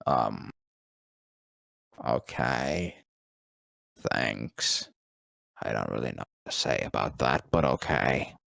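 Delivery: low, soft, fast mumble; deep actor voice. Retold or imitated. Voice actor shrugging off a fan's compliment in a low mumble.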